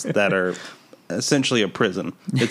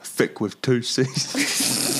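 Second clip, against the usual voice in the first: put-on Cockney accent; 3 semitones higher, 3 dB quieter, much slower than usual. Cockney joke, slow put-on voice.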